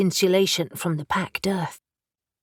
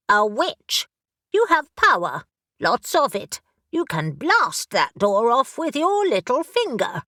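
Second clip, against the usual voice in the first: brisk, impatient talking raven; a raven who has searched everywhere and is exasperated; bossy raven; 4 semitones higher, 3 dB louder than usual. Acted. Raven character voice.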